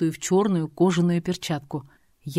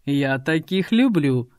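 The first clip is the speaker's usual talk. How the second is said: leering thug; 4 semitones lower, slower than usual. A narrator's reading.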